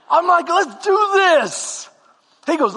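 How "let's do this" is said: determined. Retold or imitated. Psyching himself up.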